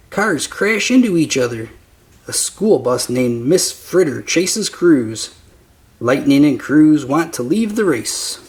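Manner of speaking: usual speaking voice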